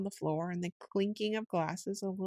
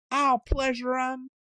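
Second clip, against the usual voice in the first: higher, louder, slowed-down vengeful snarl; furious threat; 7 semitones higher, 5 dB louder, slower than usual. A narrator's reading. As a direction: spit the threat as you charge. Toad charges the chief weasel.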